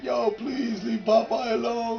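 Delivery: pleading. Retold or imitated. Friend begs cops to stop.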